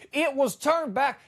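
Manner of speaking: usual speaking voice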